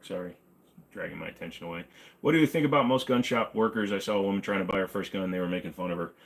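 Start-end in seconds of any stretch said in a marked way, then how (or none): none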